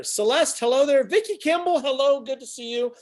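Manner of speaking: high, loud singsong hello; excited greeting. Real, not acted. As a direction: call out a delighted welcome to arriving viewers. Greeting viewers as they join.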